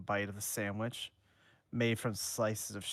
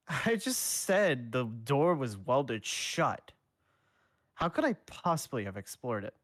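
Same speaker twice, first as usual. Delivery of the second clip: scoffing; 4 semitones higher, 6 dB louder than usual. Acted. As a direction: brush it off with a scoff. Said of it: "replied with a small scoff".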